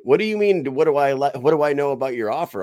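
high, loud imitated comeback; exasperated retort. Homeowner retelling his comeback to cash-offer caller, exasperated. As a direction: throw back the questions in mock disbelief.

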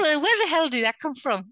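put-on a light touch of an Irish accent, half-joking; mock Irish accent. Host imitating her own angry Irish slip, light Irish accent. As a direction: toss it off in a hint of Irish brogue. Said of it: "this Irish accent will come out".

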